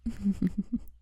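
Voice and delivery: a chuckle that rises at the end; amused chuckle. Acted. Teasing after compliment.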